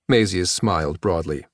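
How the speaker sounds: ordinary delivery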